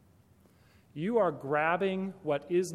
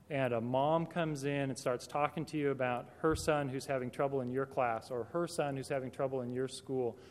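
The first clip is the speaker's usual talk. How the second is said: ordinary talk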